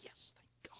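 hushed whisper of thanks; a whispered aside; whispered reply. Retold or imitated. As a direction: breathe it out, barely voiced. Husband's quiet reply to wife.

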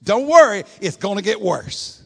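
high, loud shout with get stressed; preacher leading the congregation, loud call-out; shouted to the neighbor. Shown as a demonstration. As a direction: call it out big for the church to repeat. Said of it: "look at your neighbor right now and say".

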